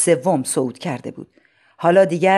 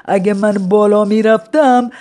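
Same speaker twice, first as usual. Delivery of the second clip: put-on rueful old man; 5 semitones higher, 5 dB louder than usual. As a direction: sigh over lost strength. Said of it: "آهی کشید و گفت".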